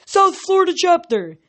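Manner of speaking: high-pitched rowdy holler; yelled roll call. A narrator's reading. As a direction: announce your chapter like a war cry. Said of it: "a centaur yelled".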